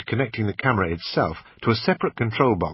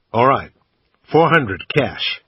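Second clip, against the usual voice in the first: put-on a loud, slow American-accented lowball offer; bad American accent; 3 semitones higher, 7 dB louder, slower than usual. Brit making a cash offer, bad American accent. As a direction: haggle in a clumsy American accent. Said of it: "in my usual bad American accent".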